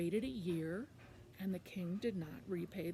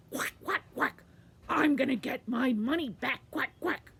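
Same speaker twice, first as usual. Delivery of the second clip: loud, quacking duck voice; 4 semitones higher, 8 dB louder than usual. Acted. Quackling declaring aloud to himself in his house, loud duck voice. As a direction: quack it out at full volume. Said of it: "he was very loud".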